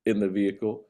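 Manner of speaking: ordinary delivery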